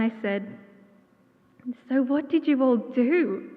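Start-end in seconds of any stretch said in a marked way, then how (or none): 1.9-3.5 incredulous retold question, slow and raised; stunned disbelief, stretched out and emphatic; shocked. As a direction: ask it aghast, higher, louder and drawn out. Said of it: "listening to this story shocked".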